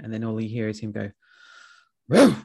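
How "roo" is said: one sudden imitated bark; angry dog bark. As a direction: bark once, sharply, like an annoyed dog. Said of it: "really angry, like, it'll be silent".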